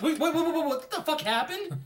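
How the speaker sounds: put-on comic lurch into high American accent; Carrie Fisher. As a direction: drop the poshness for a startled, high-pitched American accent. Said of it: "it's an American accent".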